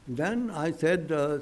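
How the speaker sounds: normal talking voice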